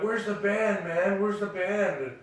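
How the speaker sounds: a pushy guest wanting his free stuff; the crowd outside Ronnie's house demanding the band, rowdy; rowdy partygoer. Retold or imitated.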